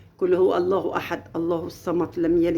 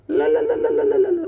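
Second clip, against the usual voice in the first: very high repeated no; shrill rapid refusals; emphatic no; 3 dB louder than usual. Shown as a demonstration. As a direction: shut the idea down hard. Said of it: "ولا الكلام ده كله".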